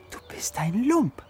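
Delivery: high, drawn-out taunt; accusing inner voice. Acted. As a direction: stretch the insult and swoop high on the last word. Innere Stimme beschimpft den Verräter. (anklagend, hoch gezogen)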